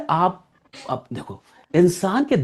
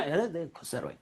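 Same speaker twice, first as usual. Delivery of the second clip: ordinary talk, nothing marked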